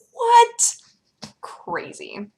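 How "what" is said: high, louder shocked what. Retold or imitated. Vlogger exclaiming what at airbrush foundation. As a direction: blurt it out in disbelief. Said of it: "And I was like".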